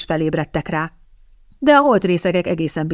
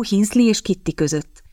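plain reading voice

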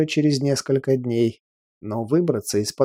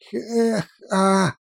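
mushy words through a broken jaw; mumbling with a broken jaw; 7 semitones higher than usual. Acted. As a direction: mumble as if your jaw is smashed. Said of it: "прошамкал сломанной челюстью".

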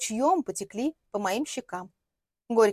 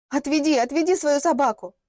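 louder, higher desperate blurt; frightened cry to call off the dog; blurted plea; 4 semitones higher, 5 dB louder than usual. Acted. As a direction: push the plea out in a rush. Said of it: "выпалила я".

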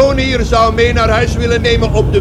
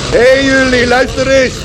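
higher, raised call over the crowd; 6 semitones higher than usual. Acted. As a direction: hail everyone to pay attention. Calling a crowd to listen. (hoog en luid roepend)

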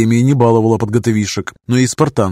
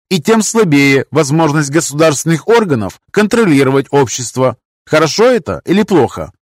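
lecturing professor; 7 semitones higher, 3 dB louder than usual. Acted.

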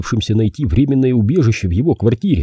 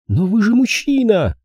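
mild furious cry; 12 semitones higher than usual. A narrator's reading. Girl challenges the detective, understated furious cry. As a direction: throw it at him, pitched way up. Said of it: "яростно выкрикнула она".

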